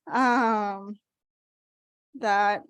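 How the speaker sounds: laughing through tears; a real, teary laugh; tearful laugh. Real, not acted. Honoree overcome during thank-you speech.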